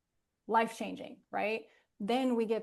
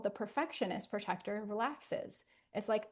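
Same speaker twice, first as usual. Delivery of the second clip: ordinary talk, nothing marked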